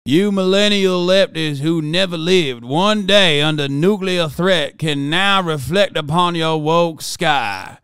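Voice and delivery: slow, pompous mock-congressman read; slow grandstanding southern lawmaker parody; Clay Higgins voice. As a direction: ham it up like a self-important politician reading his own zinger. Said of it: "I'm gonna read it in his voice".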